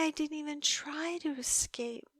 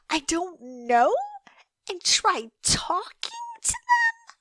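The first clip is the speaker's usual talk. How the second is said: loud, rising to a cracking high cry; scoff, then let the voice break up high; incredulous outburst; 7 semitones higher, 5 dB louder than usual. Acted. Captor flares up at the detective's dating advice, loud and incredulous. Outraged at the suggestion.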